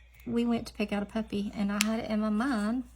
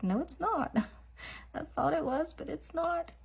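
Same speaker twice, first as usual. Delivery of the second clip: surprised; 8 semitones higher than usual. Real, not acted. Unboxing surprise at wrong guess.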